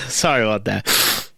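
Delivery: ordinary talk, nothing marked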